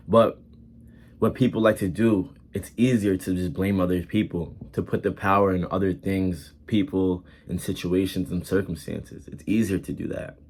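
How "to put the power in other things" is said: normal talking voice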